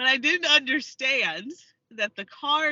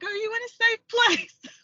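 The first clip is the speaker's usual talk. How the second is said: high, slow, sugary operator voice; sweetly concerned high voice; super-nice lady; 12 semitones higher, 3 dB louder than usual. Retold or imitated. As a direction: imitate an overly kind call-taker, high-pitched. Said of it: "The lady is super nice".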